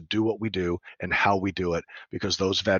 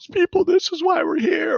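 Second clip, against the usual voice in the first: much higher and louder exclamation; exclaiming; 6 dB louder than usual. Retold or imitated. Telling the class why they are here, high loud exclamation. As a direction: cry it out to the whole room. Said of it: "And it was like".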